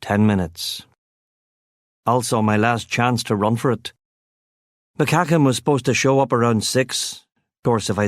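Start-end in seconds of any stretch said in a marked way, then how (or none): none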